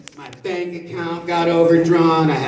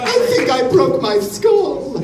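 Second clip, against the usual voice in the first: character voice; 10 semitones higher, slower than usual. Acted. Voicing a character.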